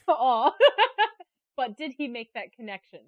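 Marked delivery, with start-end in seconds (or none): 0.6-1.2 high, loud laugh, almost a whimper; real laugh at the host's self-mockery; high sympathetic laugh. Real, not acted. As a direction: laugh warmly at his goof. Laughs after the host calls himself stupid.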